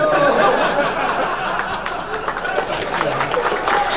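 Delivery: breathless high-pitched burst of laughter; high laughing fit. Real, not acted. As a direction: laugh hard at the canned laughter, loud.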